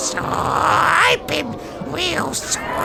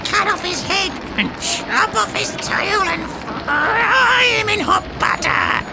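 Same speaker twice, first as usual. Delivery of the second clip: gleeful witch chant, building higher; witches crying out. A narrator's reading. Witches urging a grisly end for the boy, gleeful cries. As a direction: relish it, stretching out the final phrase. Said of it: "cried the witches".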